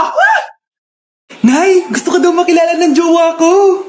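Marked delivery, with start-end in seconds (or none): 0.1-0.4 sudden high-pitched fight scream; comic attacking shriek. Acted. Wife attacks neighbour over husband.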